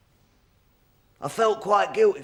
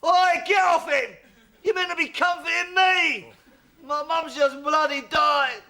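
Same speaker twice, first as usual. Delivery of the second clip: indignant shout; 9 semitones higher than usual. Real, not acted. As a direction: demand the attention back, outraged. [name] demands comfort.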